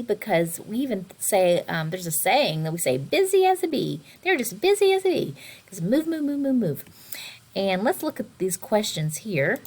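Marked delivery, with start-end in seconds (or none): 3.0-6.8 busy bee bounce. Shown as a demonstration. Teacher mimics busy bee.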